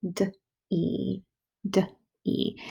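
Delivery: soft phonics demo; sounding out. Shown as a demonstration. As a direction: sound out D-E gently. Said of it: "think about my second syllable".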